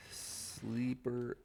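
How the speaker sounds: slow dramatic command; mock command voice. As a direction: intone it like a secret order.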